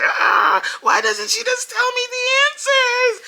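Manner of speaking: put-on a small girl grumbling in her room about mom; whiny little girl. Daughter alone in her room, grumbling about mom, high whiny child voice. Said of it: "I could hear her in her room like".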